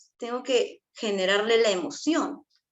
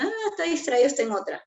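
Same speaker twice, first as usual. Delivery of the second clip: parent noticing; 7 semitones higher, faster than usual. Acted. Acts parent noticing distracted child.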